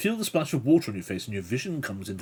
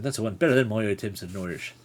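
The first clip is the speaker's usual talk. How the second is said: normal talking voice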